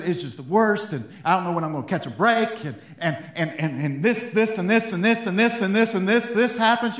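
high, loud pile-up of grievances; mock complaining rant. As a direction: reel off woes in exaggerated exasperation. Acts out piling up endless complaints.

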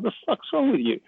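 mock a partner's disbelief in a small high voice; exasperated partner. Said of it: "And she's like".

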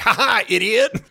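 high-pitched taunting laugh; gleeful mockery. Arcadia mocking the demon in the trap, gleeful. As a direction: laugh in the victim's face.

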